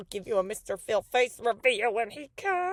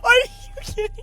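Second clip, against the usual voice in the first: loud upset disbelief; exasperated shout; 10 semitones higher, 5 dB louder, much faster than usual. Real, not acted. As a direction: yell it in exasperation. Repeated in upset.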